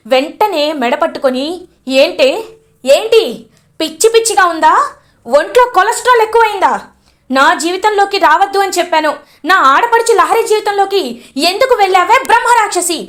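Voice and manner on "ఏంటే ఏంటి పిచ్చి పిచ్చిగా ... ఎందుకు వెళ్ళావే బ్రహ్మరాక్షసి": furious shout. Acted. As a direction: tear into her at full volume. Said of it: "గట్టిగా అరుస్తుంది శరణ్య".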